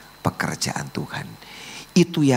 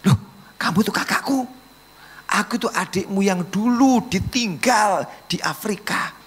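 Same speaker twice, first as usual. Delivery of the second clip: high, amazed outburst; astonished recognition; 14 semitones higher than usual. Acted. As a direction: act out discovering a lost sibling, high-pitched. Acted sibling recognition in sermon.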